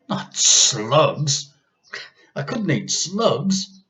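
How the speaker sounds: put-on a boy shrinking from the thought; horrified boy. A narrator's reading.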